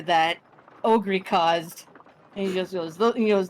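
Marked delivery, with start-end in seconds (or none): none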